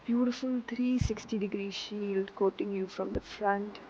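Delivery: calm, unhurried meditation guide; guided meditation. Acted. Teacher guiding students into seated meditation, calm. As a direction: settle them into stillness.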